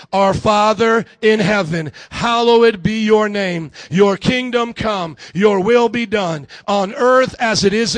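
loud, measured group prayer reading; projected scripture recitation; congregational recitation. Real, not acted. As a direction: lead the room in reciting, phrase by phrase.

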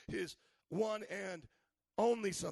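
"one" and "only" drawn out very slowly; stress on one and only. Acted. Preacher underlining "one and only" for the congregation, very slow. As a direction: lean hard on "one and only". Repeats key phrase slowly.

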